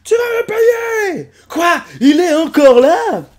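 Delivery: high, loud, slow threat; angry cartoon threat. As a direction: threaten him in a squeaky rage. Personnage menace. (menace aiguë et furieuse)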